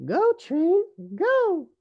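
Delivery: high, loud commanding cry; little rabbit Rosie. A narrator's reading. Rosie orders her pretend train off, high excited call. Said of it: "she said".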